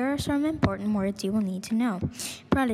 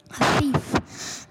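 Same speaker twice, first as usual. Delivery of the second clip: higher, raised accusing cry; a restrained screamed accusation; mild read-aloud scream; 4 semitones higher than usual. A narrator's reading. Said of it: "the Trunchbull screamed".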